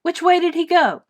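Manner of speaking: loud high demanding shout. Acted. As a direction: bark the question urgently. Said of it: "shouted the guards".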